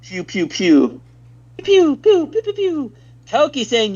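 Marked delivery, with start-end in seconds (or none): 0.0-1.0 laser noises. Pretend. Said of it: "Speck with the lasers". 1.6-2.9 much higher, louder pew pew laser sounds; high laser zaps. Pretend. Streamer answers chat about lasers with higher, louder zaps. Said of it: "Speck with the lasers".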